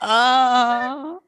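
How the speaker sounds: high, drawn-out "ah"; mock moan. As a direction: moan for a performance piece, hammed-up. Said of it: "it's her just moaning".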